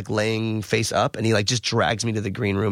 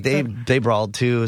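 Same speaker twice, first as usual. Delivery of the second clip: usual speaking voice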